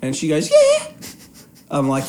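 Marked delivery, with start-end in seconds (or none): 0.5-0.9 very high, loud strained-polite "Yeah"; high, unconvincing yeah. Retold or imitated. Friend faking that she liked the podcast, squeaky high. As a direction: imitate a yes pitched too high to believe. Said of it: "the higher you say".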